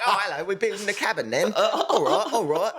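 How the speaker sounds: high, laughing fake British accent; giggling mock British brother. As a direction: ham up a posh British accent through laughter. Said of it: "speaking in a British accent".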